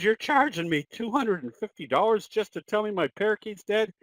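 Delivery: high-pitched, incredulous customer; playfully imitated outraged pet owner; mock indignation. Joke: owner protests the bill.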